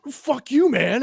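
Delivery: very high-pitched parting insult, imitating anger; mock furious. Angry customer storms off, high-pitched mock fury.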